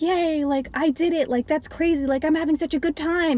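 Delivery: high, bubbly mock cheer; a hyped-up gym girl on steroids; mocking Jackie. Jackie cheering at the gym on steroids, bubbly mock voice. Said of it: "Jackie's at the gym being like".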